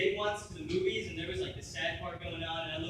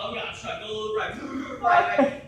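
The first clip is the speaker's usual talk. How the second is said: lightly mocked nervous driver; mock freaking out; 2 semitones higher, 9 dB louder than usual. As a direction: imitate a panicky driver, getting louder. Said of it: "he's freaking out".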